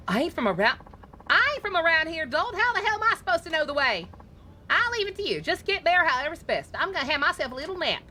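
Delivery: high, sharp-tongued Southern woman; a bossy lady calling the driver a dolt; sassy Southern lady passenger. Acted. Passenger scolding the taxi driver, high Southern lady voice.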